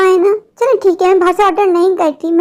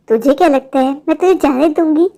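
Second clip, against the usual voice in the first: menacing witch; 4 semitones lower than usual. Acted. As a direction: lower witch voice, threatening. Witch reveals herself, threatens. (नीची आवाज़ में धमकाती चुड़ैल)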